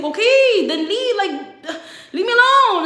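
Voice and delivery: high, loud hammed-up shouting; mock angry outburst. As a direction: imitate him yelling to be left alone. Acted breakup quarrel, his outburst.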